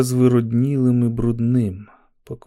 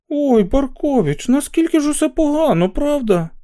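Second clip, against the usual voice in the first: high, loud fake pity; mock sympathy; 4 dB louder than usual. A narrator's reading. A walker sneers at Barkovich with high fake pity.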